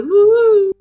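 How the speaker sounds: high, loud drawn-out woo; mock druggy whoop; woozy whoop. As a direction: let out a floaty, tripping woo. Acts out drugged student getting woozy.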